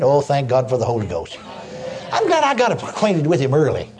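normal talking voice

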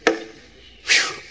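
ordinary delivery

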